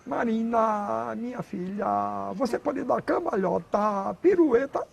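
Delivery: slow, drawn-out mock northeastern lilt; a slow, overdone fake northeastern accent; imitating TV northeastern accent. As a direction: parody a soap actress faking a northeastern accent. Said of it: "E ela falava assim".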